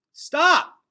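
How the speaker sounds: sharp loud shout; yell. A narrator's reading. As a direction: shout to stop them. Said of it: "yelled Gregor".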